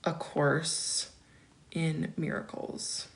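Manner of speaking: low, soft, slow and broken up; murmuring to self. Real, not acted. Host looking up a book title, murmuring to herself. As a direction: say it quietly word by word, to yourself.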